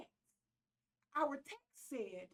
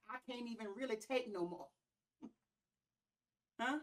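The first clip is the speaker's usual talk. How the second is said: ordinary talk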